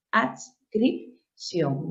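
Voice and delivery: "adscripción" very slowly, ads, crip, ción; syllable by syllable. Shown as a demonstration. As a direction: split "adscripción" with clear gaps. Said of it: "Las consonantes se separan".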